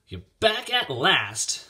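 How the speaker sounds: loud, slow, cross greeting; snapping. A narrator's reading. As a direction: snap it at him, drawing the words out. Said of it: "she snapped".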